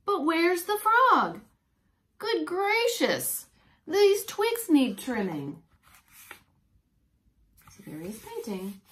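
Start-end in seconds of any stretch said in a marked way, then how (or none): 0.0-5.6 put-on slow, higher, wondering old painter; puzzled painter. Painter Mister Claude peering for the frog, slow and puzzled. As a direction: stretch out his puzzlement.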